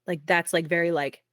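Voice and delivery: ordinary talk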